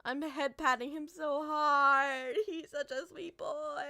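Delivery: laughing gush. Real, not acted. Gushing while patting.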